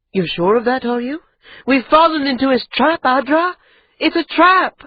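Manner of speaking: frightened insistence climbing to a shout; rising alarm. Acted. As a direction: she begs him to see it's a trap. Ida'an insisting on danger.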